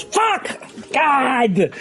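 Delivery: high, squeaky frustrated curse; a little gnome losing the hand, yelping; gnome outburst. Acted. Gnome cursing after losing at cards, high squeak. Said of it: "the gnome goes".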